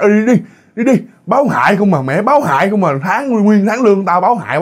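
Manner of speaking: high, mock-angry shooing; angry eviction. Workers are driven out of house.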